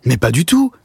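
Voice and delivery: high, enthusiastic denial; earnest protest. Acted. Alexis denies mocking his friend and praises him, eager. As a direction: protest eagerly, pitch lifted throughout.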